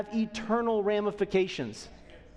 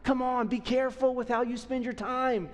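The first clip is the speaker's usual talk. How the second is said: higher, urgent pleading, like a coach urging friends on; Paul pleading; 5 semitones higher than usual. Retold or imitated. Paul urging believers not to waste time, pleading higher. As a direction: beg the believers to get moving, higher and urgent. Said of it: "I picture Paul here almost pleading".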